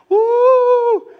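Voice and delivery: a huge high cheering whoop from the stands. Retold or imitated. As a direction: cheer like a proud grandparent at judo.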